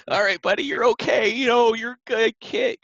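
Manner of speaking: father taking his crying son for ice cream, upbeat reassurance; reassuring dad. Retold or imitated. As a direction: lift him up and make him feel safe. Dad consoling son after tears.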